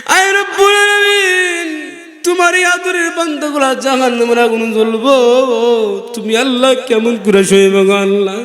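high, wailing invocation; crying out in prayer. Real, not acted. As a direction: call to God high, then come down pleading. Cries out to God in prayer. (উঁচু সুরে আকুল ফরিয়াদ)

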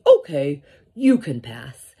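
loud, gruff troll permission; troll relenting. A narrator's reading. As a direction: grant passage like a grudging troll. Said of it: "And the troll thought, and he said".

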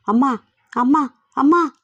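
cries building to a loud, high shout; shouting for mother. Acted. Boy Ravi in a nightmare, calling after his mother: building loud cry. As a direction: call out for her, rising into a desperate yell. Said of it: "గట్టిగా అరుస్తూ".

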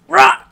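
loud high roar; huge fiery dragon roar. Acted. Monster jumps out.

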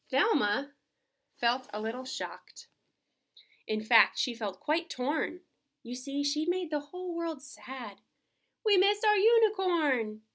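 expressive picture-book reading, swelling at the end; reading to children. Acted. Mom reading Thelma's book to kids, storybook voice. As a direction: read it like bedtime story magic.

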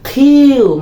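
"খিউ" high and loud with an aspirated kh; Q pronunciation demo. Shown as a demonstration. Teacher tells students how to say Q now, high aspirated demo. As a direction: stretch "খিউ" high, puffing the k. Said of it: "তাহলে কি করতে হবে?".

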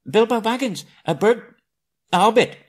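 frightened squeak. A narrator's reading. Terrified Bilbo caught by troll.

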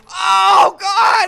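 high, loud yell; startled yell. Real, not acted. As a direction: yelp in fright.

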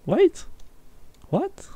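high, squeaky disbelief; sudden high-pitched shock; shocked surprise. Real, not acted. Shock at song revelation.

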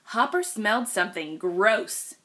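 ordinary delivery

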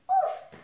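high, sudden "ouf"; relieved "ouf". Acted. Oui-Oui glad not to quarrel, high relieved "ouf". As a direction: puff out a high, loud "ouf". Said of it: "du coup, il dit".

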